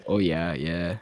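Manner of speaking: low-key Minnesota "oh yeah"; Minnesota accent. Shown as a demonstration. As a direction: imitate an agreeable Minnesotan accent. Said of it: "Minnesota, Canadian accents".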